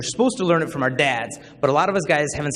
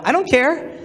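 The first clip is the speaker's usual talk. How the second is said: mock teen squeak, much higher; defensive denial; 11 semitones higher, 3 dB louder, slower than usual. Teen claiming it doesn't bug him, high mock denial. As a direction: jump up high in feigned indifference.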